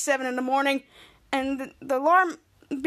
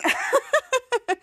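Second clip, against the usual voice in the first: high-pitched staccato mock laugh; imitated laughing; 9 semitones higher than usual. Jake and Piper laughing at the video, imitated laughing. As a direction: act out two people cackling. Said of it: "Jake and Piper were laughing".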